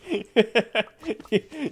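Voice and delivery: high, loud burst of laughter; real laugh. Real, not acted. Streamer correcting a viewer's word, loud high laugh. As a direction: crack up mid-correction.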